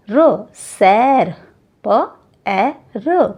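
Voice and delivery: प, ए, र drawn out one by one; sounded out very slowly. Shown as a demonstration. Teacher to children, sounding out very slowly. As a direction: stretch पैर into separate sounds. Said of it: "उच्चारण बड़ा आता है".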